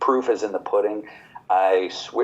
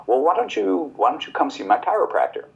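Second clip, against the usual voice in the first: mom's suggestion in a higher, quicker voice; voicing mom; 7 semitones higher, faster than usual. Son retells his mom suggesting her chiropractor, higher and quick. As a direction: lightly play his mother offering help.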